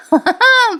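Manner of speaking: high chuckle, imitating a father; acted laugh. Father amused by his son's joke, laughing. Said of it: "Prataprao हसायला लागतात".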